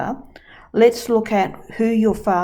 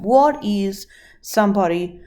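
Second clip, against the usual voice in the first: usual speaking voice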